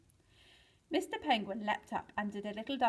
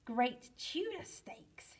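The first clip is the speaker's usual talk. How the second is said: astonished cry; 3 semitones higher, 4 dB quieter, much slower than usual. A narrator's reading. Mister Penguin sees swirls in her eyes, astonished. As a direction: stretch out the exclamation in wonder. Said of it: "he cried".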